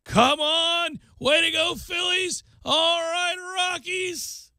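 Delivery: high-pitched cheer, pushed out; re-enacted yelling. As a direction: cheer the teams on from afar, voice way up. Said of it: "I kept yelling".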